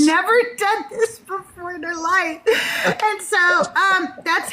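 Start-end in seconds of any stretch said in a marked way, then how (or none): 0.1-2.4 high-pitched mock disbelief; incredulous. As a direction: imitate a baffled onlooker, voice pitched up. Acts out Doug's baffled reaction to her.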